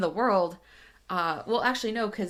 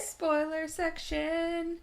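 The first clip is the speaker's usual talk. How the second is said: high, drawn-out segment-intro voice; playful announcer; 9 semitones higher, 4 dB quieter, much slower than usual. Pretend. Cohost announces the spoiler part, playful announcer. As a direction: stretch it out like a mock jingle.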